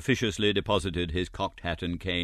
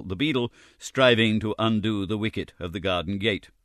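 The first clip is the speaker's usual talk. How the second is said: plain reading voice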